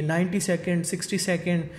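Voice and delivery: usual speaking voice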